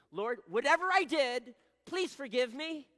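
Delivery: very loud, high, drawn-out mock plea; overdone apology. Shown as a demonstration. Man begging the Lord to forgive whatever he did, overdone plea. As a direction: belt out a blanket apology. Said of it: "But never say this".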